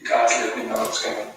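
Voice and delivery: tinny, through a phone speaker; like sound from a phone. Real, not acted. Echo heard through phone.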